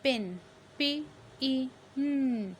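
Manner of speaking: पिन, then each sound apart, final एन hummed; sounded-out word. Shown as a demonstration. Teacher shows the vowel, slowly sounding out पिन. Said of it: "ये ई की आवाज़".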